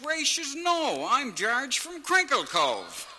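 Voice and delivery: put-on high, loud, slow country-boy voice; naive George. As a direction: squeak it out like a green outport lad. George voiced as naive outport lad.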